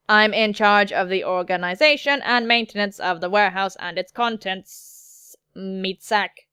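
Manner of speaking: steady, level robotic delivery; measured machine monotone; android voice. Acted. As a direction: recite it like an android, pitch held steady. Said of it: "Something about the android voice".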